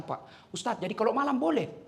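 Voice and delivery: hushed question. Retold or imitated. Congregant asks quietly.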